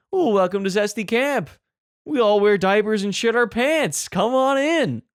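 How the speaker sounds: hammed-up host's greeting, rising in pitch; welcoming camp host. Camp host in pajamas greeting the arriving family, hammed-up welcome. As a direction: welcome them in with mock gusto. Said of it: "this guy walks out in his pajamas".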